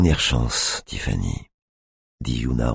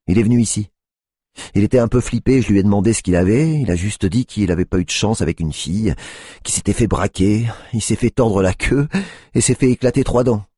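Tiffany's voice; 7 semitones higher, 3 dB louder than usual. A narrator's reading. Tiffany tells the story.

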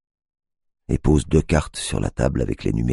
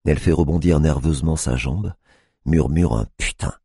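ordinary delivery

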